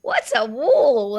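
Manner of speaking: drawn-out confused classmate question; puzzled kids. Retold or imitated. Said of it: "And they'd say".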